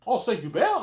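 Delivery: high, loud imitation of her surprise; surprised question. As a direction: echo the place back in mock astonishment. Retells her surprised echo question.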